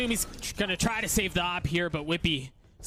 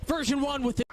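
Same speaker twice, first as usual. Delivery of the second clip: esports caster; 11 semitones higher than usual. Acted. Caster calls a clutch play.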